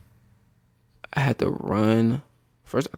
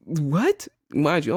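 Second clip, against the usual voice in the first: normal talking voice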